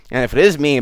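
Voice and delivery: ordinary delivery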